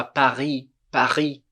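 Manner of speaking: "Paris" with guttural R and silent S; "Paris" as pa-REE, R rasped in the throat; throaty French R. Shown as a demonstration. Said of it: "r com a garganta".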